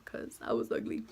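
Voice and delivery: breathy whispered joke about herself; whispered aside. Real, not acted. Girl jokes about her looks in a whisper. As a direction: drop to a whisper for the punchline.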